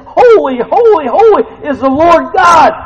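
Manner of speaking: high, loud angelic proclamation; ringing, forceful preacher's shout; crying out praise. Acted. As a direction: shout each holy in full worship. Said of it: "They're saying, we sang it today".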